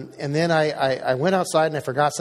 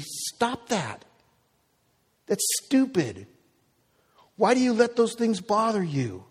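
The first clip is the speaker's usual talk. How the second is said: a hint of God's stern rebuke, raised higher; God scolding; 9 semitones higher than usual. God telling the pastor to stop his habits, stern. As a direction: scold gently but firmly from above. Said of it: "God is saying to me".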